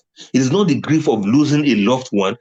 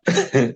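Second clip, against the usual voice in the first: short leftover chuckle; real laughter. Real, not acted. Trying to recover from the joke, chuckling briefly. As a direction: let out a brief giggle. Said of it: "This is quite funny to me".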